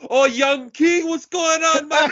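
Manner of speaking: very high, excited street greeting; hammed-up high-pitched hail; imitated hat man. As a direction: hoot it high like a delighted old acquaintance. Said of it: "and he's like".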